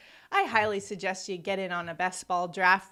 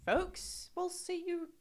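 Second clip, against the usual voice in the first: high, hammed-up London accent; a playful London sign-off; 9 semitones higher, slower than usual. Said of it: "and my London accent".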